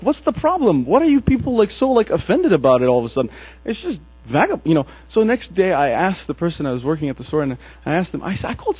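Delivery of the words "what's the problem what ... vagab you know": high puzzled question. Retold or imitated. Immigrant asking coworkers about tramp, baffled and high. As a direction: voice jumps up, baffled and indignant.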